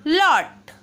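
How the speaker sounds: लॉट said with a short open aw; teacher models लॉट, open aw sound; model word lot. Shown as a demonstration. English teacher models लॉट for pupils, short open aw. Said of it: "इसको ऐसे पढ़ेंगे लॉट".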